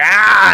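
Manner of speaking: short loud high yelp of fright; startled scream. Retold or imitated. Colonel seeing the doll pop out, startled scream. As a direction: jump out of your skin with one yelp. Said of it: "he's like".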